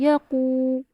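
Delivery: قو lengthened to two counts; Quran recitation. Shown as a demonstration. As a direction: recite یقو with a long قو. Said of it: "دیکھیے، من". (قرآنی تلاوت کا انداز)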